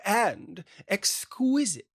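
slow, elegant put-on accent on exquisite; mock-fancy unplaced accent, stretched out; drawn-out posh accent. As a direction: savour the word like a socialite. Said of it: "And exquisite, I might add".

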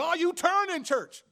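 high, loud, drawn-out revival cry; hammed-up preacher. Mock preacher rousing the church about turning, hammed-up holler. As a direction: whoop it out like a fired-up preacher, half laughing. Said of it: "if I was a certain type of preacher".

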